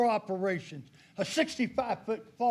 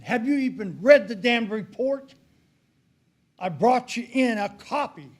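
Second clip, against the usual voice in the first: raised, higher voice with heated indignation; indignant confrontation; 5 semitones higher, 5 dB louder than usual. Retold or imitated. Man confronting the sheriff over an unread report, indignant. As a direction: challenge him hotly, pitched up.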